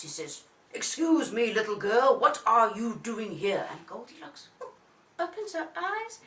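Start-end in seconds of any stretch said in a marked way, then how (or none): 0.7-3.7 loud, stern bear father; Daddy Bear shouting. A narrator's reading. Daddy Bear challenges the little girl, loud shout. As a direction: demand it loudly in a big Daddy voice. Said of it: "And Daddy Bear shouts".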